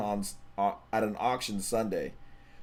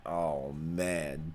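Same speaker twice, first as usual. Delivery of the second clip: ordinary delivery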